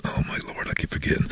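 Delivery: hushed inner-voice aside; muttered thought. Retold or imitated. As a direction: whisper it to yourself as a private thought. His private thought on being recognised.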